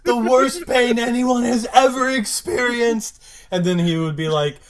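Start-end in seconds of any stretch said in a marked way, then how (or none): 0.0-3.2 mild mock agony. Echoing Xander's agony.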